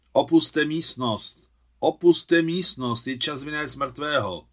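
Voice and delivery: loud, high, drawn-out commands; bossy ordering. A narrator's reading. Švábr herding everyone from the room, ordering loudly. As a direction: call out slowly to clear everyone out. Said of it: "honil všechny Švábr".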